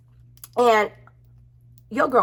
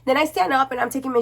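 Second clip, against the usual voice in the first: usual speaking voice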